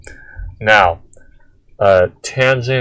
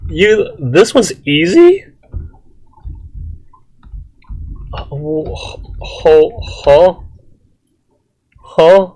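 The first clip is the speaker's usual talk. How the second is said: incredulous; 9 semitones higher, slower than usual. Real, not acted. Incredulous at "easy" integrals.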